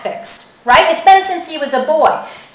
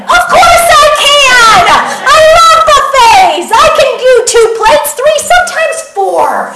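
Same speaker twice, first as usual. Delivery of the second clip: very high, loud comic outburst; indignant shouting; 11 semitones higher, 8 dB louder than usual. Acted. Preacher asked if he can manage the buffet, hollering indignantly. Mock outrage at buffet question.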